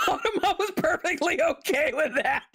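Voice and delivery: high, laughing through the words; real laughter riding on the whole sentence. Real, not acted. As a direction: crack up while saying it. Laughing at own joke.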